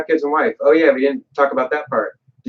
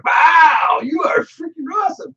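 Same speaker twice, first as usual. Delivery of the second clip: shrieking high mock praise, much higher; gushing crowd; slower than usual. Crowd hailing Hercules, hammed-up high-pitched awe. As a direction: squeal it like an adoring fan.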